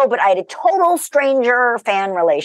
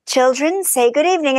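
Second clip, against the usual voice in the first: a light posh English lilt, a bit higher; mock-British greeting to the kids; put-on British accent; 5 semitones higher than usual. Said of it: "Totally British accent".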